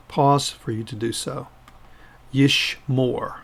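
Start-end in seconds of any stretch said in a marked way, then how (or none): 2.3-3.4 "Yishmor" slowly, split into two syllables; pronounced for repetition. Shown as a demonstration. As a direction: model "Yishmor" slowly for learners to copy. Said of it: "pronounce them after me".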